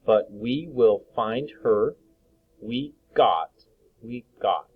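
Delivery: slow, careful reread; teacher rereading for the class; slow reading aloud. Acted. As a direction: read the sentence slowly again.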